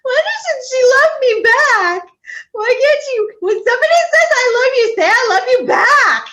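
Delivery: mock wounded whine, way up high; whiny hurt plea. To her friend who won't say it back, a high hurt whine. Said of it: "I was like".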